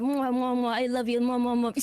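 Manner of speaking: playful kissy mwahs; kissing noises. Retold or imitated. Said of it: "making kissing noises with each other".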